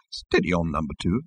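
soft, low, mild rebuke; quiet, low-voiced chiding; gentle admonishment. A narrator's reading. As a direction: gently rein in an underling. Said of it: "admonished the captain gently".

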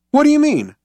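high, startled demand; a sudden high what; surprised. A narrator's reading. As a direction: jolt up in disbelief. Zaphod startled.

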